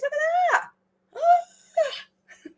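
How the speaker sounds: high wailing squeal at the grey hairs; mock horror. Real, not acted. Vlogger wails to viewers over her grey hairs, mock horror. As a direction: cry out in half-joking dismay.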